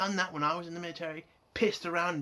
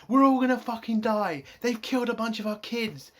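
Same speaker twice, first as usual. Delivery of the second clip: louder, hammed-up doom; mock panic; 5 semitones higher, 7 dB louder than usual. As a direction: blurt it like a joke about dying. Mocking an over-the-top joke.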